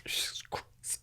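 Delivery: real emotion, nearly no voice; choked-up whisper. Real, not acted. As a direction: let it fall to a whisper, overcome. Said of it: "with emotional tears now".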